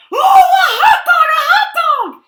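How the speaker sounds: very high, loud delighted squeal; excited pigeon. A narrator's reading. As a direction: shriek with joy as the excited pigeon. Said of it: "Look how excited he is".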